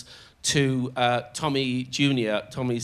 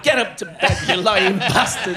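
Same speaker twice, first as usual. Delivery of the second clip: high, loud scolding shout; mock angry wife; 5 semitones higher, 6 dB louder than usual. Wife catching husband drinking on his own, mock angry shout. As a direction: imitate a wife yelling him off to bed. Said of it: "And so [name] went".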